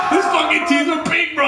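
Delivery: loud high shout of disbelief; excited shout. Real, not acted. Telling friends the gross climax, shouting.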